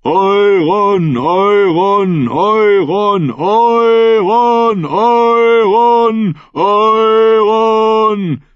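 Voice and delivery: high, long-drawn roared chant; full roar. A narrator's reading. Thousand voices call Euron's name, high climbing roar. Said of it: "Das Gebrüll rollte Nagas Hügel hinauf".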